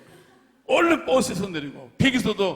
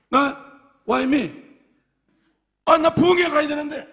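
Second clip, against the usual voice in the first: loud, high indignant cry, louder at the end; pastor protesting; 9 semitones higher, 6 dB louder, slower than usual. Retold or imitated. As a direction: protest loudly that you must get to the revival. Pastor protests being pulled off.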